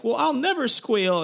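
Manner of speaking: put-on high-pitched girl voice; mock defiant girl. Retold hoodlum girl defying captors.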